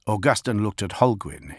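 ordinary delivery, nothing marked

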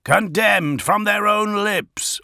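high, slow, ringing accusation; outraged cry. A narrator's reading. Augustin seizes on the confirmed signal, crying out high. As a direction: cry out the condemnation. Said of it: "Augustin cried".

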